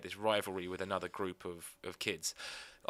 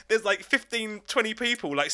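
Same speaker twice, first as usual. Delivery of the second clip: usual speaking voice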